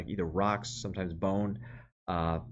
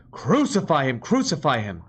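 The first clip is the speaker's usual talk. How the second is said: higher, louder shouted demand; crowd shout; 6 semitones higher, 8 dB louder than usual. Acted. The crowd cries out to Pilate, loud angry shout.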